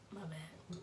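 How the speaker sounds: slow sheepish whisper. Real, not acted. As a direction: whisper it, embarrassed. Sheepish aside.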